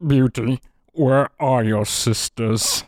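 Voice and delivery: slow, hoarse, muffled take on a choked Alan Rickman; a light parody of a mumbling father; father's voice. Retold or imitated. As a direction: talk like a golf ball is stuck in your throat. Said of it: "I'm gonna try to do the father's voice".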